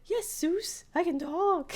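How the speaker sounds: the pool duck answers him; squeaky duck voice. Retold or imitated. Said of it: "It's like".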